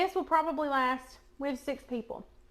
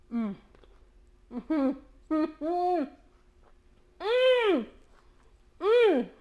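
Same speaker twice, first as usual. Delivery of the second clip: savoring hum; 4 semitones higher, 4 dB louder, much slower than usual. Real, not acted. Tasting the dessert.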